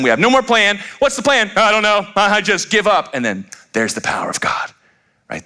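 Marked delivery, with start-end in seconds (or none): 0.0-3.1 high-pitched mock desperation; panicked giving up. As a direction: climb into a frantic, helpless squeal. Acting out running out of plans.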